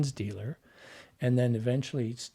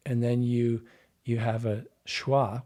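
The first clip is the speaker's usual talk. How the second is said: ordinary talk, nothing marked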